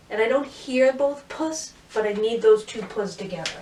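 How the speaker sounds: usual speaking voice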